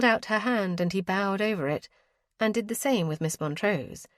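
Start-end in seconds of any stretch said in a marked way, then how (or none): none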